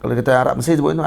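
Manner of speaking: normal talking voice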